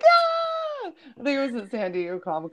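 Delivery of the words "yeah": very high cheer through a laugh; crowd cheering. Retold or imitated. Panel audience cheers Gwendolyn's answer, high laughing cheer. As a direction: yell it up like a whole panel agreeing. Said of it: "And everybody was like".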